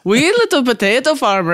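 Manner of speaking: sing-song Irish-Swedish lilt again, "little" stressed; Irish Swedish accent. Shown as a demonstration. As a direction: mimic a Swede-Irish parent saying it. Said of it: "that's how you would say that sentence".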